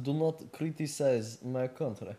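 put-on Russian accent with halting delivery. Podcast host welcoming listeners as Vladimir, Russian accent.

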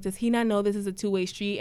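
ordinary talk

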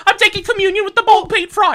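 loud, high English gabble with laughter; giggly high-pitched British caricature; quick mock English accent. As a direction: rattle it off in a squawky English voice. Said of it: "you gotta say it quick".

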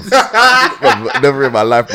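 laughing, loud and high. Real, not acted. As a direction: crack up mid-sentence. Laughing at the teacher's pregnancy.

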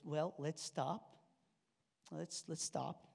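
soft, slow, gentle stop; wife calmly halting. Retold or imitated. Wife gently calming her frustrated husband, soft and slow. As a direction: hush him down, slowly. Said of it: "she goes".

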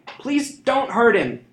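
high, loud, slow pleading cry; fearful plea. A narrator's reading. Sister begging the thief to spare her brother, terrified. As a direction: beg him frozen with fear. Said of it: "Nella called out, frozen with fear".

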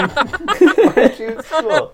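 high, slow laughing scold; mock scolding adult. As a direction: play a nagging grown-up, laughing.

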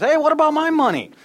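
high, loud heckling shout; heckler's shout. Acted. A man in the crowd shouting at Jesus about his money. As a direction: call out from the crowd, demanding.

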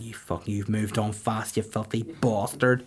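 muttered insult. Retold or imitated.

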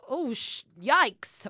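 high, loud mock exclamation; censored swearing. Shown as a demonstration. Co-host showing on-air swear substitutes, mock exclamation. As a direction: catch the swear and swap in a squeal. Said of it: "we have to say".

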